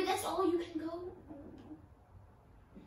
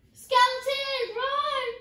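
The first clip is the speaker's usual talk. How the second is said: screaming at a skeleton; 9 semitones higher, 8 dB louder, much slower than usual. Real, not acted. Skeleton attacks in game.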